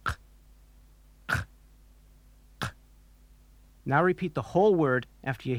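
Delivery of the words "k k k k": "K" popped deep in the throat; far-back K. Shown as a demonstration. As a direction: burst "k" with tongue pulled right back. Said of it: "Now say it along with me".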